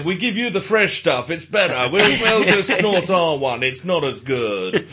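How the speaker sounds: hammed-up Eastern European accent, laughing; mock Eastern European accent. Mock Eastern European accent mimicking safari guides offering elephant dung. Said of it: "I don't know why I'm doing this accent".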